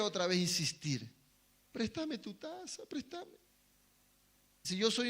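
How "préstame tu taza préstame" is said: soft, slow wheedling ask; coaxing plea. Acted. Preacher voicing Satan coaxing to borrow the cup, soft wheedling plea. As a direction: sweet-talk to borrow the cup.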